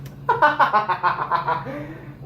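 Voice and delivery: high burst of laughter; laughing. Real, not acted. Laughing at the man in the video, amused laughter. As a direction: crack up laughing.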